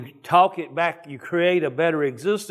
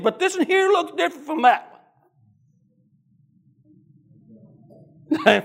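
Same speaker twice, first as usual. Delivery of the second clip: preaching at full force; 7 semitones higher than usual. Acted. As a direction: preach it hotter and higher. Preaching builds, devil's thoughts.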